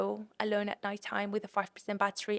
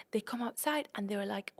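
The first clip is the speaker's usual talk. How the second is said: normal talking voice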